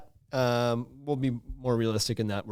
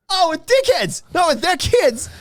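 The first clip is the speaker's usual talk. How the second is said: much higher, louder, delighted; sudden realization; 6 dB louder, slower than usual. Retold or imitated. Host finally getting the joke, high and loud. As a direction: squeal it as the penny drops. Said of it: "He's like".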